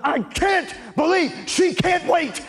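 high, slow, exasperated inner whine; a boy's hammed-up inner complaint, high; inner grumble. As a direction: complain to yourself, dragging it out. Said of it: "inside, I'm going".

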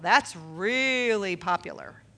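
"really" stretched out long and slow; low, slow line lingering on "really"; drawn-out emphasis. Real, not acted. Mocking a common excuse, drawled.

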